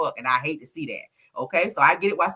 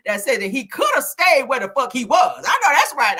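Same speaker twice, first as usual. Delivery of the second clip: 1 loud reading; 8 semitones higher, 5 dB louder than usual. Retold or imitated. Reading comment aloud, loud.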